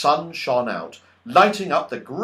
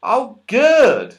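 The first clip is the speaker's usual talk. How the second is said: pleased; 7 semitones higher, 6 dB louder than usual. A narrator's reading. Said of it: "said Moonface".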